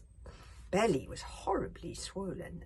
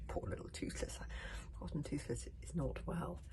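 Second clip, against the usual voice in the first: ordinary delivery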